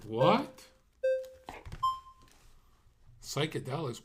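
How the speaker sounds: loud, high, startled what; surprised. Real, not acted. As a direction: blurt it out in disbelief. Reacting to psychedelics remark.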